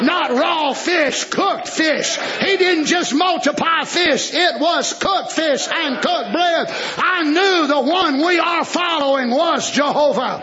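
shouted revival preaching; preaching at full force. Real, not acted. Preacher shouting to his congregation about cooked fish, full force preaching. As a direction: hammer it home to the congregation.

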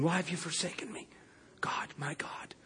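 very low whispered lament; hushed, desolate prayer; forsaken, lonely. Retold or imitated. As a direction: whisper it as one abandoned by God. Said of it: "That forsaken feeling, that lonely feeling".

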